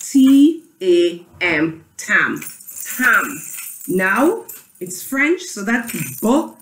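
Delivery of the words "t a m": "T A M" slowly, letter by letter; spelled out. Shown as a demonstration. Teacher spelling the first syllable for class, "T A M" letter by letter. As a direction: give each letter of "T A M" its own long beat. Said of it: "So let's spell tam".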